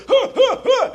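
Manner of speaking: high, loud repeated gasps; loud whooping mock wheeze; asthma attack gasps. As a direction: imitate a kid gasping for air. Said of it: "he's having an attack".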